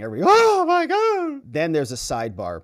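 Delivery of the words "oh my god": very high, slow, loud "oh my God"; stunned disbelief; shocked gasp. Retold or imitated. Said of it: "all the press was gasping".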